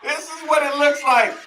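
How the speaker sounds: laughing through it. Real, not acted. Laughing at old game footage.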